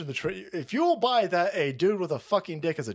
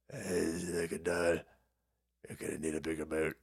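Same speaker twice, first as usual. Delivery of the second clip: much softer, low hushed aside; hushed mock dread; 10 dB quieter than usual. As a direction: mutter it low like a scared aide. Voicing shocked staffer.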